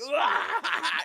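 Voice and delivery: very high, loud mock cackle; imitated wicked laugh. Imitating the demonized answering "come out now", wicked laugh. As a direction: let out a hammed-up evil laugh. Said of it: "they'll, like, wicked laugh".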